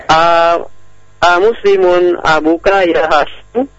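usual speaking voice

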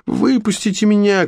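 put-on high shout of a trapped girl. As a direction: cry out to be let go. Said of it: "кричала Златовласка".

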